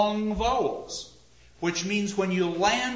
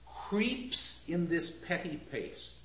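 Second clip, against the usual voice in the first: slow, long vowel on Creeps; slow, attentive to vowels; vowel sounds; 5 dB quieter, slower than usual. Shown as a demonstration. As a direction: savor the double E in Creeps. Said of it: "Listen to the vowel sounds".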